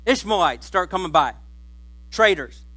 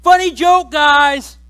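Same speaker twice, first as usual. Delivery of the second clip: nervous joking Joseph; 6 semitones higher, 7 dB louder than usual. Acted. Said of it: "He's like".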